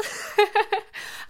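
high creepy cackle; creepy cat lady cackle. Acted. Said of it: "I sound like a creepy cat lady".

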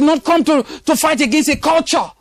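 ringing declaration of heaven's civilization; preaching climax. Real, not acted. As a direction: sing it out to the congregation.

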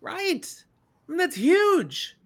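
wow, this is big; excited. Real, not acted. Reacting to Lan's offer.

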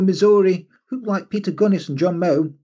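normal talking voice

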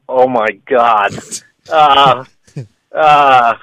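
mock-agonized reluctant refusal; retold reluctant no. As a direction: stall on drawn-out uhs before admitting it.

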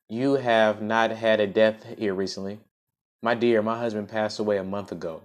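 clear, fuller storytelling voice; story narration. Acted. Host reads the story to listeners, in a storybook narration. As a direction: read it out like a storybook.

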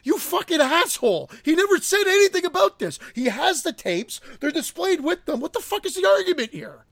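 mild mock fury at a rude collector; retold outburst. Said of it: "And I'm like".

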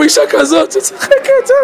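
high, amazed exclamation with a laugh; Germans marveling. Retold or imitated. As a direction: act out the guards' laughing wonder at her. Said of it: "אמרו".

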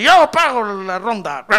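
loud, slow, boastful drunk impression; mock drunk. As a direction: bellow it like a generous drunk. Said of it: "usted, borracho, dice". (imitando a un borracho fanfarrón)

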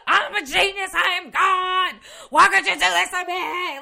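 high, loud mock scream of a man dragged away; imagined ranting scream. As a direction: rant it out, screaming and kicking, hammed-up. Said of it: "ranting while he's screaming".

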